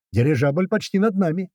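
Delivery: high, urgent alert; yelled alert. A narrator's reading. Driver warning the lads of an airship overhead, yelling high. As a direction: warn everyone of what is overhead. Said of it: "заорал Крот".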